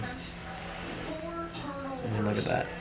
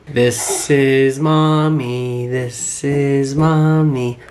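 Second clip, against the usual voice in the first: slow teaching voice; 2 semitones higher, 6 dB louder, much slower than usual. Real, not acted. Father teaching baby family names, slow and drawn out.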